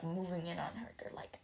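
hushed unvoiced narration; whisper. A narrator's reading. Storyteller whispering as guards close in. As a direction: whisper it like a secret.

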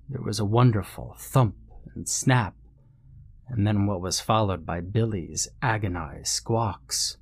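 usual speaking voice

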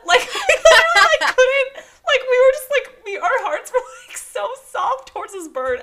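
high, laughing through the words. Real, not acted. Telling her cohost about the bird, laughing high. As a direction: giggle as you tell it.

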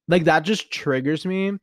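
ordinary talk

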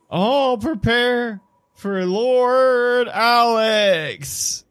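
high, long-drawn ceremonial call; herald's announcement. Acted. Herald announces Lord Alex to the party, high drawn-out call.

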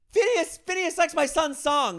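high, loud and slow excitement; giddy news. Retold or imitated. Father telling the video crew excitedly. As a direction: announce it in disbelief. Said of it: "I'm like".

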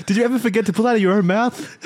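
words breaking into giggles; laughing while reading. Real, not acted. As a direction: read it while you crack up. Reading absurd chat, cracking up.

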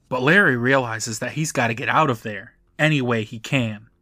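normal talking voice

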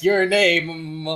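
ominous drawn-out name; menacing. Retold or imitated. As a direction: say it like a threat. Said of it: "I don't like how you said my name".

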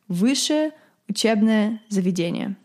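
высшее учебное заведение in native Russian; Russian pronunciation. Shown as a demonstration. Teaching viewers the full Russian term, native pronunciation. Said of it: "this is a little Russian lesson".

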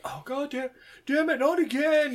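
high frustrated groan of a voice; exasperated mock curse. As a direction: whine it out, fed up. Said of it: "and it's like".

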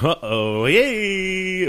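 mock cheer. Mocking trope reaction.

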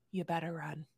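low, quiet fatherly threat; threatening dad. Acted. Pretend dad warning Dempsey's partner, quietly threatening. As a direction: drop the voice right down as a warning. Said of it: "if I was Dempsey's dad".

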